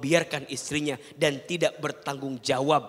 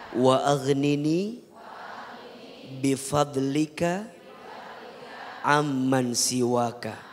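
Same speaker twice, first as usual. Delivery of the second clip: slow, chanted Arabic prayer; sung supplication, measured and reverent; prayer recitation; slower than usual. Acted. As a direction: intone the dua slowly and devoutly.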